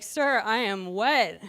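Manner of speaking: very slow, deliberate and deadpan; slow deadpan reply. Retold or imitated. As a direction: drag each word out for the punchline. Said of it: "And I was like".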